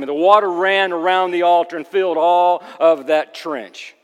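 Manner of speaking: loud, driving Bible storytelling; forceful preaching narration. Acted. Preacher telling the congregation of the altar, forceful.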